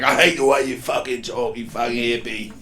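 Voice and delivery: mean Cockney put-down; Jason Statham. Acted. Inner voice insulting the hippie, put-on Cockney. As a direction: sneer it in mock Cockney. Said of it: "It actually is Jason Statham".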